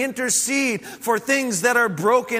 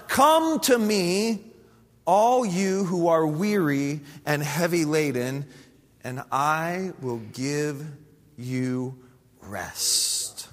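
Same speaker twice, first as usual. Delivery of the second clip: tender invitation; 6 semitones lower, slower than usual. Acted. Tender quote of Jesus' invitation.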